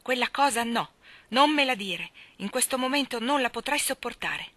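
put-on loud, furious young man; furious outburst. A narrator's reading. Laurie, hurt and jealous, to Jo: furious loud outburst. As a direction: lash out at her before she can speak. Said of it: "esclamò furente".

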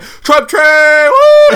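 very high, loud whoop of a trucker; hollering trucker. Retold or imitated. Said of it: "He's like".

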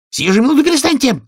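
fast, high-pitched shrieked order; scream. A narrator's reading. Queen screams at the bowing gardeners, fast and high. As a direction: shriek it quickly, demanding instant obedience. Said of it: "завопила королева".

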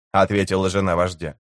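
ordinary delivery, nothing marked

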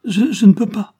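very high, loud stammered protest; desperate stammer. A narrator's reading. Child gives up after vain attempts, desperate stammer. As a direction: stumble on the first word in distress.

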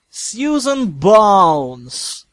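very slow, loud cold drawl; stern roll call. Acted. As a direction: stretch the name out menacingly. Teacher calls roll.

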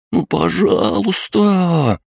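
high, slow whiny pleading; children pleading. A narrator's reading. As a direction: beg like little kids. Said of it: "их жалобные просьбы".

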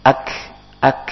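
"Ak" with a puff of breath released after the k; breathy k. Shown as a demonstration. Teacher showing the breathy kaf as in the call to prayer. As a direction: let air escape after the k in "Ak". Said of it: "keluar huruf hamasnya".

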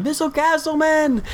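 very high mock yell, stretched at the end; imitated yelling. Man banging on the CEO's door, high mock yelling. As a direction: call out in a high-pitched hammed-up yell.

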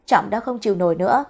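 ordinary delivery

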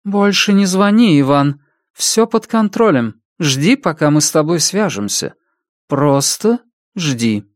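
high, insistent orders; firm woman on phone. A narrator's reading. Woman tells Иван to stop calling, high insistent voice. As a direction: order him firmly, slowing at the end.

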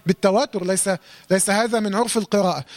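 ordinary talk

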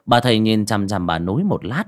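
plain reading voice

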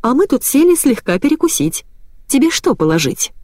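bright, high, happy chirp; joyful chirp. A narrator's reading. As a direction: chirp it out with delight. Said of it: "радостно прощебетала".

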